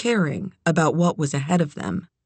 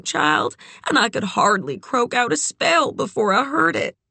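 higher-pitched character voice, shaken storyteller; Doc's distressed recollection, pitched up; grieving friend Doc; 4 semitones higher than usual. Acted. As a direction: recount the dreadful night in a raised voice. Doc tells of horse's death.

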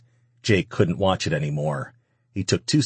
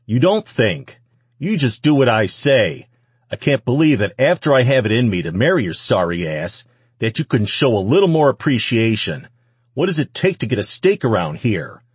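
loud, raised-pitch berating; angry tirade; 5 semitones higher, 5 dB louder than usual. A narrator's reading. Man berating his cowering wife, angry tirade. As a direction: lay into her with contempt.

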